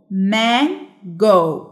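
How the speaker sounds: "mango" very slow, broken into man and go; split into syllables. Shown as a demonstration. Teacher counting vowel sounds for pupils, "mango" syllable by syllable. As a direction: stretch "mango" out, a gap between man and go. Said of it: "علشان كده دي تبقى كام syllable".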